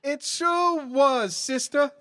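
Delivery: put-on high, fluttery old woman; old lady voice. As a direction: gush like a genteel old lady. Two old ladies chatting.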